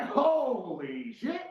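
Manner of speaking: loud, high, drawn-out disbelief; retold amazement, long and high; stunned. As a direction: stretch it out in shock. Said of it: "I was like".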